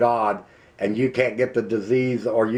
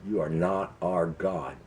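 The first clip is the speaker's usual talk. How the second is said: quiet intense defiance; 5 semitones lower, 7 dB quieter than usual. Real, not acted. Vowing defiance at Congress.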